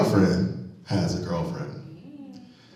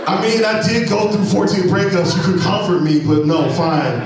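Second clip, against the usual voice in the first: higher, louder fake hurt; mock offended; 9 semitones higher, 5 dB louder than usual. Comic chiding the crowd for laughing at his breakups. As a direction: play wounded at the crowd.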